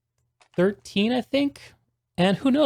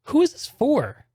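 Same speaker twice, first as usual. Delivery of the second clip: high, slow, drawn-out question; baffled; 11 semitones higher than usual. Real, not acted. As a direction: ask it in confused disbelief. Confused by the wrong intro.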